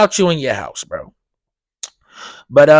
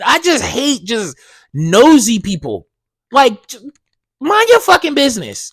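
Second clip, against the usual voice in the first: exasperated rant; 8 semitones higher than usual. Real, not acted. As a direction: vent at nosy people, worked up. Ranting about nosy people.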